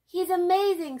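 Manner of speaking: high, loud, thrilled burst; excited. A narrator's reading. Arthur gushing to DW about the bionic bunny, excited. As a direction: gush about his hero.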